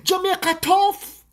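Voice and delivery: high, loud, slow mock wail; mocking bawling. Foreign woman on video bawling, mocking wail. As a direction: imitate a foreigner crying about Jamaica. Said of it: "the woman a ball and she a say".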